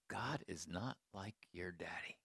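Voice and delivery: soft, low, near-whispered consolation; gentle reassurance. Retold or imitated. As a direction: retell it tenderly, hushed and low. Gently consoling the woman in story.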